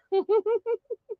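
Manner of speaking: high little giggle; quick soft laugh; light laugh. Real, not acted. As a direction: giggle while greeting. Host laughing during greetings.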